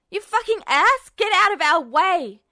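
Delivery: high, loud screech of rage; furious high-pitched screech. A narrator's reading. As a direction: scream it at the man you're hitting. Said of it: "he screeched".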